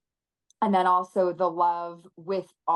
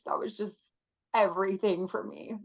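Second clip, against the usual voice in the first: usual speaking voice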